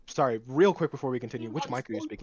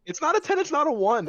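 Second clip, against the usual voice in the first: high mocking echo; 11 semitones higher, 5 dB louder than usual. Echoing rating in high mock voice.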